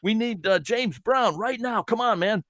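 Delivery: lightly voiced pushy plea, high; mock urgent demand at Basil. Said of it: "And it's like".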